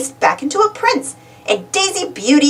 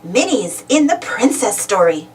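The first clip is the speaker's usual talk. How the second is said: usual speaking voice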